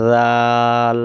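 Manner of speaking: teacher models the letter name জাল for students; letter name demo. Shown as a demonstration. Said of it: "এ অক্ষরের নাম কি বলেন তো?".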